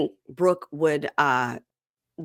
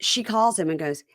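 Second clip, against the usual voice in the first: usual speaking voice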